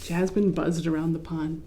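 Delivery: plain reading voice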